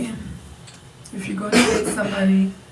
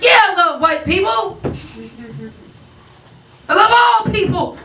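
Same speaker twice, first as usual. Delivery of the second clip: much higher and louder, climbing; overeager reassurance; 7 semitones higher, 3 dB louder than usual. Acted. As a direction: insist too hard that she loves everyone.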